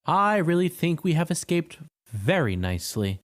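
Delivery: lightly voiced, a touch of pride; mildly pleased with himself. A narrator's reading. Tin Woodman after the fight, a hint of pride. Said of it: "in a tone of pride".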